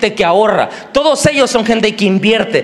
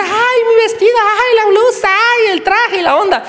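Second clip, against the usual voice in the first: much higher, hammed-up despairing wail; mock desperation; 12 semitones higher than usual. Preacher mimics a woman over her stained dress, mock despair. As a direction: wail "ay" over the ruined clothes, overacted. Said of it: "en desesperación tú dices".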